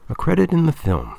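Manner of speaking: high-pitched, wondering repeat; awed echo. A narrator's reading. Debate coach tempted by his name in lights, high and wondering. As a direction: lift it high, savouring the offer.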